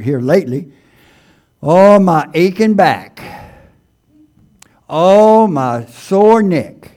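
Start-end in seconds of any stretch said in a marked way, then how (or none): 1.6-6.9 mock complaining moan. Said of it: "we've all done it probably".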